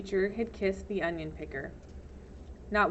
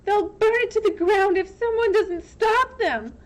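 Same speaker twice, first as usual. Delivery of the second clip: high urgent panic; frantic; 12 semitones higher, 7 dB louder than usual. Acted. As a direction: push the words out in alarm. Frantic plea to sheriff.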